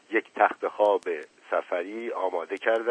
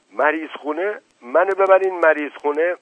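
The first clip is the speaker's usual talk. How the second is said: higher, louder incredulous questions; indignant disbelief; 7 semitones higher, 8 dB louder than usual. A narrator's reading. Sick uncle balking at going to hospital, indignant disbelief. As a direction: repeat it back in raised, startled protest. Patient alarmed at hospital plan.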